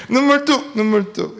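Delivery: mock stern command. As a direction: order a child to stop crying. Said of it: "이런 이야기를 하는 거죠". (우는 아이에게 엄하게 "눈물 뚝!")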